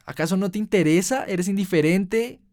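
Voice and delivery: high, loud, accusing outcry; angry shout at God. Retold or imitated. Furious at God for letting the world suffer, shouting. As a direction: rage at a God who stays away. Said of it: "le grité".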